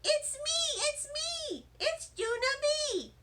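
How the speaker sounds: high, excited holler across the room. A narrator's reading. First grader hollering to the janitor, high and excited. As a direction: shout his name like you're thrilled. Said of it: "I hollered out".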